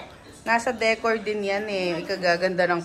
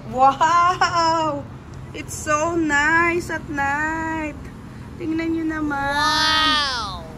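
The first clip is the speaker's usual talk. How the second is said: higher, thrilled at the night view; excited wows; 6 semitones higher, much slower than usual. Real, not acted. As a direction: gush over the city lights. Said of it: "Ang lakas pala ng boses ko". (masayang-masaya at mataas ang boses)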